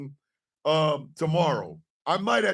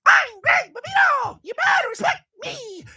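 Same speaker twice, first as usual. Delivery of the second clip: much higher, loud squeaky boast; squeaky small man voice; 4 dB louder than usual. Retold or imitated. Mocking a short man, a loud high squeaky boast. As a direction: puff up like a tiny tough guy.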